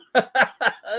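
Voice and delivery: quick high laugh; real laugh. Real, not acted. Viewer laughing at her calling fans dirty.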